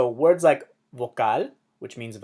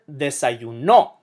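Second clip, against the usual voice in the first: "desayuno" as de-sa-yu-NO; "desayuno" with a loud stressed ending; stress on last syllable; 4 dB louder, slower than usual. Shown as a demonstration. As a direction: punch the final no of "desayuno". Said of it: "puts the emphasis on the last syllable".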